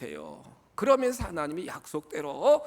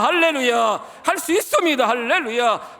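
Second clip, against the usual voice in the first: rousing proclamation; 3 semitones higher, 8 dB louder than usual. Acted. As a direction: proclaim it to lift the crowd. Preacher's rousing declaration.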